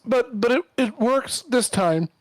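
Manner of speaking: loud, slow, shaky voice. Acted. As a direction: stammer it out, voice trembling. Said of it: "his voice is, like, really shaky".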